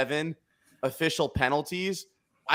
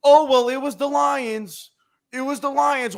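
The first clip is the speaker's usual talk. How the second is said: mock doubter; 9 semitones higher, 4 dB louder than usual. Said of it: "everyone's gonna say".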